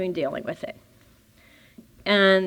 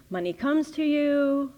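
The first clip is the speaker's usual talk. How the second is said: ordinary talk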